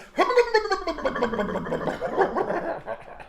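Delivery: high drawn-out laugh; long cackling laugh. Real, not acted. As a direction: laugh at own joke. Long laugh after joke.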